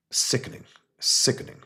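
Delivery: hissed in disgust, breathy; disgusted whisper. Real, not acted. The speaker condemns letting the family die, whispered disgust. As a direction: spit the word out in whispered disgust.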